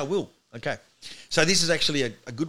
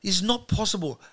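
higher, excited refusal to believe; retold disbelief; 6 semitones higher than usual. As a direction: insist it cannot be true. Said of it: "I'm going".

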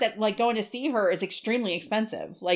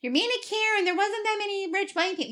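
mock critic; 12 semitones higher than usual. Imagined viewers scold her.

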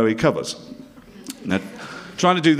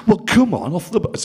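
louder and higher Bolton command to get off; brisk Bolton call; 10 semitones higher, 4 dB louder than usual. Retold or imitated. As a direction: hurry him off the bus, Lancashire style. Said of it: "he went".

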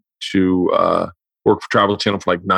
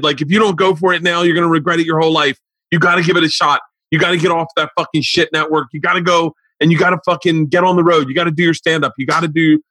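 higher, mock tearful plea from dad; retold crying dad; 7 semitones higher than usual. Father begging son to chase his dream, mock tearful. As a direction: imitate the dad pleading through tears, lightly. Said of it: "My dad starts crying uncontrollably".

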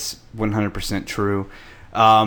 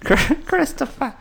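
very high wailing name call; shrill wail. Retold or imitated. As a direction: shriek the name, drawn out. Imitating the character's wail.

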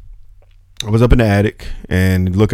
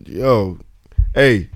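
ordinary delivery

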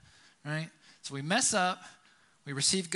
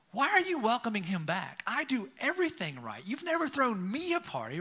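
higher, louder indignant complaint; mock angry brother; 7 semitones higher, 4 dB louder than usual. Older brother complains to father, mock indignant. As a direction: whine like the wronged good son. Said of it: "he gets mad".

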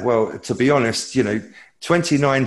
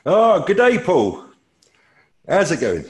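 hammy Aussie greeting, pitched way up; a loud, higher try at an Australian accent; 8 semitones higher, 5 dB louder than usual. Retold or imitated. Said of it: "that's my best attempt to an Australian accent".

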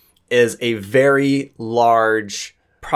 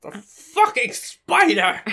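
high, slow strangled squeal; high put-on frightened voice; spider panic; 14 semitones higher, slower than usual. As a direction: squeal it in comic horror. Eccentric character panics at a spider.

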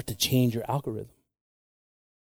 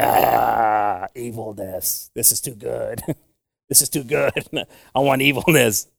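gleeful evil computer; slower than usual. Pretend. Computer voiced as gleeful villain.

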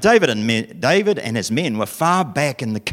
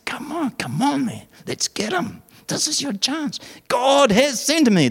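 high, excited egging-on voice; eager urging mates; 14 semitones higher than usual. Acted. David's men urge him to kill the sleeping king, high and eager.

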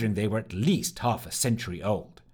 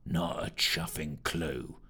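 growled; 7 semitones lower, 8 dB quieter, slower than usual. Acted. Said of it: "growled Fitch".

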